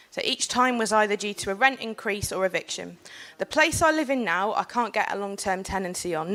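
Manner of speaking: earnest public speech to a panel; formal speech. Acted. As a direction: speak it like a prepared talk. Delivers prepared panel speech.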